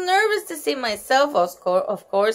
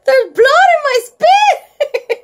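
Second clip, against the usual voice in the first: high, loud, drawn-out shriek; hollered; 13 semitones higher, 7 dB louder, slower than usual. A narrator's reading. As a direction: wail in horror, stretching the words. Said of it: "I hollered some more".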